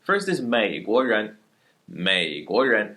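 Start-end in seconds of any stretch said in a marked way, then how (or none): 1.9-3.0 美国人 said slowly, 美 low third tone then 国人 rising; tone demo. Shown as a demonstration. Teacher shows students the tones, slow and careful. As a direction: stretch 美国人 to show each tone. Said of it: "third tone, second tone, second tone".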